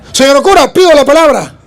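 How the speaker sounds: loud, high, eager call for the floor; eager request. Retold or imitated. Child asks the bishop's leave to speak, loudly and eagerly. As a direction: claim the floor boldly.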